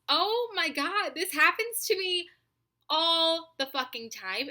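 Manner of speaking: venting at how often it happens; exasperated. Real, not acted. Vlogger to viewers, exasperated and drawn out. Exasperated rant about drive-throughs.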